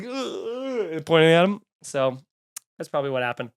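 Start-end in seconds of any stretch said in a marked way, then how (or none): 0.0-1.0 high, soft mocking "Eh" from a goofy movie bit; Jim Carrey eh. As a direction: squeak out a sly, pointing "Eh". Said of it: "he's like".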